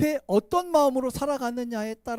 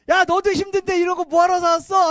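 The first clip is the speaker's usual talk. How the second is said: higher and louder fond scolding; mock-scolding parent; 8 semitones higher, 8 dB louder than usual. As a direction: tut at a child who overspent. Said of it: "하면서 웃으시죠".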